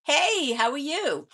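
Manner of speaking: loud, high-pitched hello; bright greeting call. Real, not acted. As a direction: call out a friendly greeting to someone just arriving. Greeting someone who just arrived.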